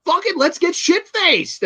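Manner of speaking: high, loose tipsy voice; drunk giddy quote. Retold or imitated. Friends trapped in a car deciding to drink, high tipsy voice. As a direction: toss it off like a drunk giving up. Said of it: "And they're like".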